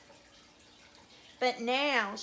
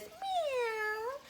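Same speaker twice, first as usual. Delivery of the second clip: high drawn-out meow; cat's meow; 11 semitones higher, 4 dB quieter than usual. Shown as a demonstration. Storyteller showing children what a cat says, high meow. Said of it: "A cat says".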